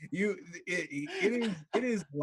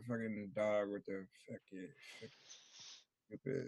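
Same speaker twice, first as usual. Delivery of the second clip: put-on drunk mutter; 5 semitones lower, 13 dB quieter than usual. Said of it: "Ricky looks down ... and goes".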